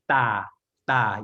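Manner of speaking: "da" starting between a D and a T; unaspirated hard T. Shown as a demonstration. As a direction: say "da" with no puff of air on the consonant. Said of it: "So it's not aspirated again".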